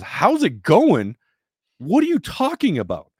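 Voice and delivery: loud, high, drawn-out mock outrage; incredulous outburst. Rescued rower answering the oblivious president, incredulously outraged. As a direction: throw the greeting back slowly, loud and high, in disbelief. Will's outraged reply to casual greeting.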